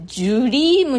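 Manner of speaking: ジュリーム with a "j" onset in place of "dr"; jream sound. Shown as a demonstration. Teacher showing how English dream sounds. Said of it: "ジュリームに近い".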